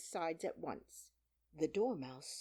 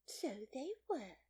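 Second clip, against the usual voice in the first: high, slow, whispery dormouse voice; half-asleep little creature; sleepy Dormouse; 8 semitones higher, slower than usual. A narrator's reading. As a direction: tell it drowsily in a feeble high voice.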